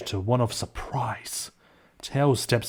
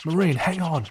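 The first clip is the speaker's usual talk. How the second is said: mild raised call; yell; 7 semitones higher than usual. Acted. Tails flying towards falling Marine, yelling. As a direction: call out to her mid-dive. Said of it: "Tails yells".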